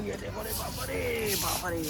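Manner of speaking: hushed, drawn-out, high-pitched alarm; scared gasp of words, soft and slow; nervous. Real, not acted. Nervous climber peering down the ladder, hushed alarm. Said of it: "डर तो लग रहा है मुझे".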